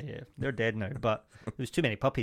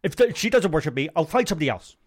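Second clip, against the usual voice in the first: Andrew Tate impression; 2 semitones higher, 3 dB louder than usual. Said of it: "Andrew Tate".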